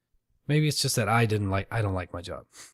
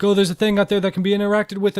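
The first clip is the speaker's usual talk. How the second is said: much higher, louder and fast; hammed-up eager joy; mock excitement; 11 semitones higher, 9 dB louder, faster than usual. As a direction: gush at something to interact with. Mock thrill at possible company.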